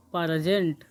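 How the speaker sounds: प्रेजेंट drawn out with an extra vowel, "pa-ra-jent"; mock mispronunciation. Shown as a demonstration. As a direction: say प्रेजेंट low and slow like a rustic learner, "paara-jent". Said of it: "प्रेजेंट नहीं". (प्रेजेंट का देहाती गलत उच्चारण, "पारजेंट")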